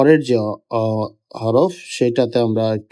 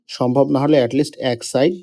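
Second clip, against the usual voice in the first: usual speaking voice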